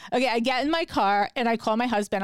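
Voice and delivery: normal talking voice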